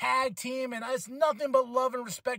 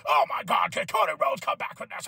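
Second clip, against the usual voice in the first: lightly mimicked hyped commentator, pitch a bit higher; imitating a commentator. Vlogger mimics commentator before a commercial, light hyped call. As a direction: play a wrestling commentator whose voice climbs before a break. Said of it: "the pitch of the commentator gets a little bit higher".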